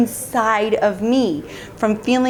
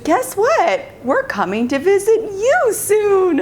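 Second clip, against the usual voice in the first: high, teasing announcement, stretched at the end; spring the news with mock glee; gleeful sign-off; 11 semitones higher, slower than usual. Gleeful sign-off of her email.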